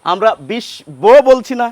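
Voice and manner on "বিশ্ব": বিশ্ব said as "bish-bo" with the ব clearly sounded; b pronounced. Shown as a demonstration. Teacher demonstrating the spelling-pronunciation nobody uses, b sounded. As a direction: say বিশ্ব with a deliberate b, the wrong way. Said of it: "এই বয়ের উচ্চারণ করছি না".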